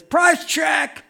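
loud, high call across the store; cashier calling out. Retold or imitated. Cashier calling for help at the checkout, loud shout.

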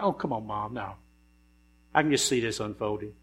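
lightly voiced Jesus grumbling to his mother; reluctant son. Son grumbling to his mother, a touch mock-reluctant.

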